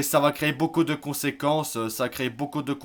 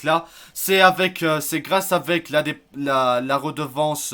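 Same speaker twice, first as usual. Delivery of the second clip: stumbling over the words, restarting; bafouillage; 2 semitones higher, 6 dB louder, slower than usual. Real, not acted. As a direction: lose the thread, drawn-out hesitations and false starts. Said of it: "je bafouille". (bafouiller en cherchant ses mots)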